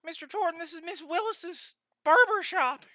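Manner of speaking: put-on high sweet old lady greeting. GM playing the barber greeting a customer, high old lady voice. GM voicing Miss Willis greeting.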